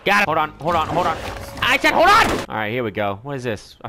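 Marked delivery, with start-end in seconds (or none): 1.6-2.3 higher, louder repeat; sudden raised-voice demand; exasperated yell. Real, not acted. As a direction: insist they wait, losing patience. Escalates after repeated hold on.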